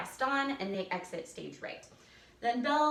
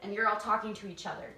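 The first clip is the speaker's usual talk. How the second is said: ordinary talk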